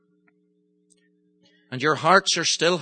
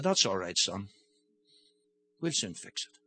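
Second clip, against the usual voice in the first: low, soft and reassuring; calm the frightened son, lower and quieter; gentle father; 7 semitones lower, 7 dB quieter than usual. Retold or imitated. Father gently comforts his son.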